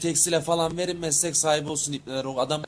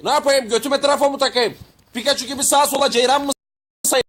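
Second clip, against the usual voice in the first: high, loud indignant protest; exasperated retort; 6 semitones higher, 4 dB louder than usual. Acted. Man rejected for lacking electricity, exasperated retort. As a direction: hit back loudly at the rejection.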